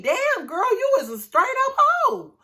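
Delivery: high, loud outrage; outraged rant. Real, not acted. Vlogger blasting Lacey for betraying her husband, high outraged yell. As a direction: go up and let her have it. Ranting at cheating wife on show.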